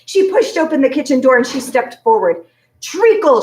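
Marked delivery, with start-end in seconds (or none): 2.8-3.4 high, loud declaration; shout. A narrator's reading. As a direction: blurt the word out proudly. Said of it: "she shouted".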